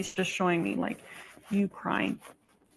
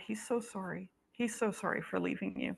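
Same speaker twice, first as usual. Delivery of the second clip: much softer, voice dropping with feeling; hushed and emotional; 11 dB quieter than usual. Real, not acted. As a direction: hold back tears and say it softly. Medium tearing up, relaying apology.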